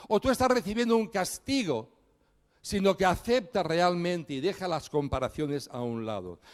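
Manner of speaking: ordinary delivery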